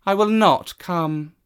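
a cat who answers to no one; haughty cat. Acted. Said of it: "said the cat".

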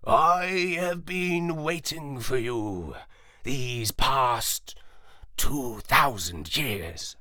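a lightly voiced wise old dog, slow and clear. A narrator's reading. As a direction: speak slowly and plainly, like an ancient dog. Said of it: "he said clearly and without an accent".

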